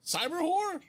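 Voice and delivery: baffled question. Acted.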